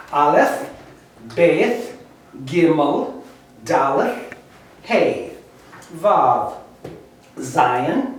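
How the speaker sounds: slow, deliberate recitation, each letter spaced out; reading the alphabet aloud. Shown as a demonstration. Teacher reading the Hebrew alphabet for students to follow. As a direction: call out every letter clearly for students to follow.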